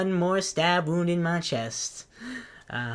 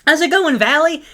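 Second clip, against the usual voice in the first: much higher, chirpy hello to a friend; overeager greeting; 10 semitones higher, 4 dB louder than usual. Retold or imitated. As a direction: greet them brightly, far too friendly. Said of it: "It's like".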